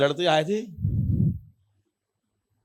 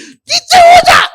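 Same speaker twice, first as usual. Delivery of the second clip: very high, loud strained shout; frantic yell; 15 dB louder, slower than usual. Retold or imitated. Hiding soldier ordering the boy down, frantic yell. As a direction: scream the order at top pitch.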